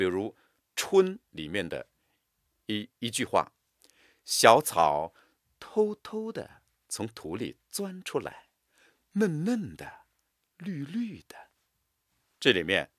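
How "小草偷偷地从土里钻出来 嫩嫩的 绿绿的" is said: 偷偷地 soft and light, 绿绿的 whispered; soft stress, fading to a whisper. Shown as a demonstration. Teacher reading a spring poem, softly. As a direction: hush the key words tenderly. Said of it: "是采用重音轻吐".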